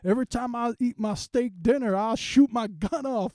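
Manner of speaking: high, loud cowboy whooping; a yee-haw patriot showing off; a mock Southern gun nut whooping at dinner; hammed-up gun nut. Mocking a patriotic gun owner.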